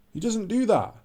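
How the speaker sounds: usual speaking voice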